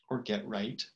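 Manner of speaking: unhurried meditation guide with long pauses; calm, spacious guidance, letting each phrase settle; guided meditation. Real, not acted. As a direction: lead them gently into the breath, phrase by phrase.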